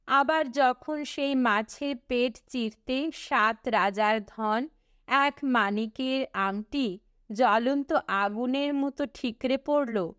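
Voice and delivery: usual speaking voice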